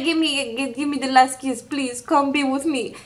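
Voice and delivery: softly imitated begging; half-hearted lover's plea; mock pleading. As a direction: plead in a small mocking voice. Mocking his plea for a kiss.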